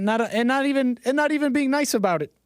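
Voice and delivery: rising outrage, voice going up; exasperated rant. Real, not acted. Ranting about entitled migrants.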